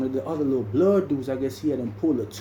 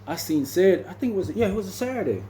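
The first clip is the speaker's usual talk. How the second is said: ordinary talk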